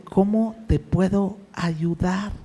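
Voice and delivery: slow, soft, kindly offer of help; gentle king. Retold or imitated. The king asks Nehemiah how to help, slow and soft. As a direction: lean in and offer help slowly like a caring king. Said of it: "Y el rey le contesta y le dice".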